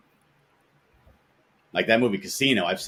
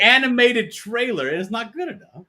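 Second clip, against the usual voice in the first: much higher, huffy offended artist; mock indignation; 11 semitones higher, 5 dB louder than usual. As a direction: play the wounded artist, hammed-up.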